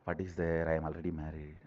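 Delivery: low, soft shrug of a voice; mock resignation. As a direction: imitate someone who has stopped bothering. Voices married person neglecting grooming.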